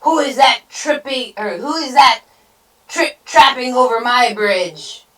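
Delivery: loud, high troll challenge; blustering troll demand; roared. A narrator's reading. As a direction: roar at whoever is on the bridge. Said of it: "roared the troll".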